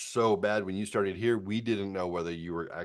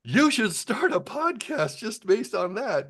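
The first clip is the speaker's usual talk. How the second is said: higher, thrilled "Whoa!" that eases into a slower pitch; excited suggestion; 8 dB louder, much slower than usual. Retold or imitated. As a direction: mimic the nurses excitedly urging him on. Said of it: "And they were like".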